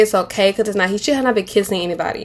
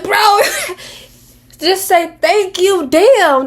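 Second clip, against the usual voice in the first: exasperated retort; 9 semitones higher, much slower than usual. Retold or imitated. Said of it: "And I'm just like".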